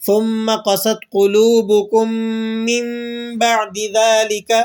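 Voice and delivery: measured Quran reading, noon of مِّن turned to m; tajweed iqlab recitation. Shown as a demonstration. Teacher reciting another example from the Quran, tajweed iqlab recitation. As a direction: recite with مِّن hummed as a held "mimm" before بَعْدِ. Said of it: "ہم میم کی آواز نکال رہے ہیں".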